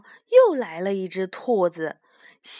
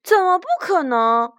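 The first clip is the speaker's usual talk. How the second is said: higher, louder protesting comeback; indignant retort; 7 semitones higher, 5 dB louder than usual. Acted. Little rabbit Banban arguing back at the newcomer, indignant retort. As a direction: throw the challenge back, pitched up. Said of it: "斑斑反驳道".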